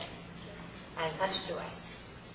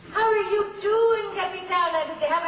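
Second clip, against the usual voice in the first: mock sweet greeting; 8 dB louder than usual. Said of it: "co-star greets her sweetly".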